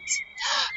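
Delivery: high, excited gasp; sudden delighted intake of breath. Real, not acted. Teacher hearing a train outside, gasping with excitement.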